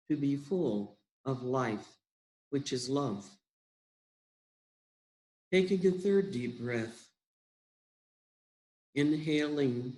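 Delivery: guided meditation. Acted. Leader guiding listeners into breathing and love, unhurried meditation voice. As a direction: guide them gently inward, phrase by unhurried phrase.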